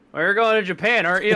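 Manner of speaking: a hint of a cheerful grandpa; mock grandpa. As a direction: play the old man, matter-of-fact. Grandpa voiced in story.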